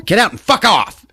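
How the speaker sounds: high, put-on yell; mock angry yell. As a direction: yell it as an acted dismissal. Acting sister yelling brother away.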